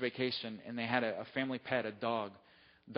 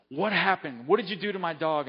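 louder, demanding mock-angry father; father phoning the dog hotel, louder and indignant; upset father; 4 semitones higher, 8 dB louder than usual. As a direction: demand answers like an upset dad.

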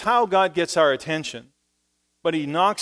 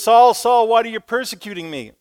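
much louder, higher calling out; booming heavenly voice; 8 semitones higher, 10 dB louder than usual. Acted. Voice of Jesus calling Saul on the road, loud. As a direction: call his name from the heavens. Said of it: "you hear this voice. It says".

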